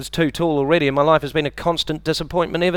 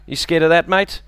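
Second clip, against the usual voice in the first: ordinary delivery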